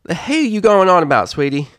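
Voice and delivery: loud, exasperated filly outburst; sputtering. Acted. Applebloom demanding what Sweetie means, loud. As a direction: sputter in disbelief. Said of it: "Applebloom's voice sputtered".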